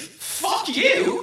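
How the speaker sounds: high-pitched playful insult; mock shouting match. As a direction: yell it back in fun. Mock quarrel over the name.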